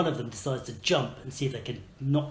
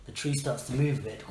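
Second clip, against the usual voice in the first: normal talking voice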